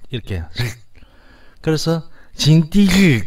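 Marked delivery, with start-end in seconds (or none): 2.3-3.2 징디 slow, high and loud, syllables apart; 징디 drawn out syllable by syllable; careful Navajo pronunciation. Shown as a demonstration. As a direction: sound out 징디 slowly for the class. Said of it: "이렇게 발음합니다".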